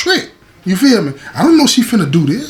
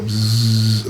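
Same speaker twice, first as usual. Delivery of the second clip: imitated low buzzing noise; vibrating buzz; 9 semitones lower, 4 dB quieter than usual. Man describing what he heard on the phone, vibrating buzz. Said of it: "A little vibrating sound".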